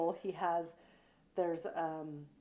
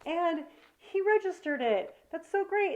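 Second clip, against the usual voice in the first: higher-pitched gushing praise; retold delight; 7 semitones higher than usual. Wife retelling her praise of her husband, delighted. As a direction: gush as you re-enact being pleased.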